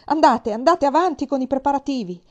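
commanding order. Acted. Queen ordering hesitant servants.

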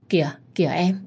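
ordinary delivery

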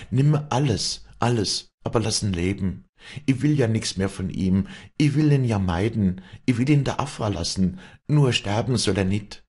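put-on kneeling girl pleading with God in prayer; desperate prayer. A narrator's reading. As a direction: beg God for his life. Wally prays over Joseph.

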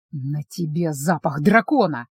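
low, slow, strained vampire line; a lightly voiced vampire, words squeezed out. A narrator's reading. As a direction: force the words out through anger. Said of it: "выдавил он".

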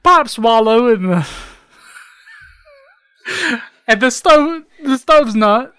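high, halting British parody; silly put-on British accent; mock British.

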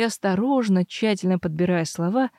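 ordinary delivery

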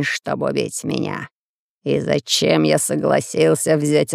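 put-on low, slow, despairing old villain; old man voice. A narrator's reading. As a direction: drag it out in dread.

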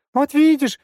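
put-on very high, loud child's squeal; excited squeal. A narrator's reading. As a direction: squeal it like a thrilled kid. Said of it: "возбуждённо завизжал".

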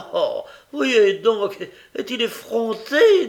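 high, loud, slow outraged cry; mock indignation. Young woman calls the boy shameless to bystanders, loud. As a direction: play scandalised for the onlookers. Nancy mocks to the crowd.

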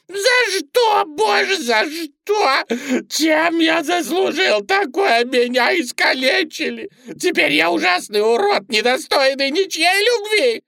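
very high, loud wailing lament; shouted squeaky despair; anguished rabbit. A narrator's reading. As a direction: cry out to heaven as the maimed bunny. Mutilated bunny laments to God.

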